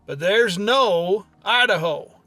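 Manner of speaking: ordinary talk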